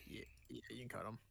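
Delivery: very soft, low mumble; barely audible, low reply; too quiet. Real, not acted. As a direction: say it almost under your breath. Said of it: "say hello louder for me".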